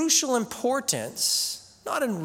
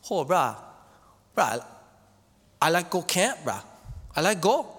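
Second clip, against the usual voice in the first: Hawaiian pidgin. Retold or imitated. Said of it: "in Hawaiian pidgin is what they speak".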